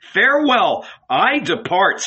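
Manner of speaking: high, loud anguished farewell; Jesus cries his farewell to the disciples; cried out. A narrator's reading. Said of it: "he cried".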